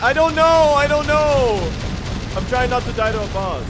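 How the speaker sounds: very high, loud flustered yell; exasperated shout. Real, not acted. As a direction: yell it under pressure mid-fight. Challenged by chat while fighting boss.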